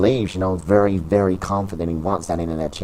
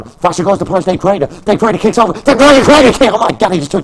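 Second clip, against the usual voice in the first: much higher and louder, racing to the kick; excited yell; 8 semitones higher, 9 dB louder than usual. Acted. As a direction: shout the surprise attack. Surprise run-in and kick.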